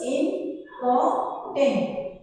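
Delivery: important slowly in three separate syllables, im, por, tant. Shown as a demonstration. Teacher showing a three-syllable example, important split slowly syllable by syllable. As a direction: break important into im... por... tant, pausing between. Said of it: "where I have again three".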